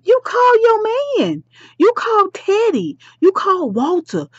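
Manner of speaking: high-pitched, insistent list; exasperated advice. Pretend. Vlogger tells absent Duchess whom to call, exasperated. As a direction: lecture her as if it were obvious.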